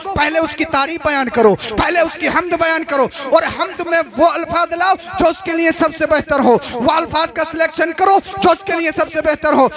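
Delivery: forceful, ringing sermon delivery; emphatic Urdu sermon oratory; impassioned preaching. Real, not acted. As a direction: preach it to a whole congregation, phrase by phrase.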